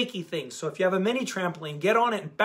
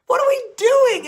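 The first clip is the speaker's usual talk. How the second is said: much higher and louder, laughing disbelief; exasperated outburst; 11 dB louder than usual. Real, not acted. Exasperated at everyone's bad habits.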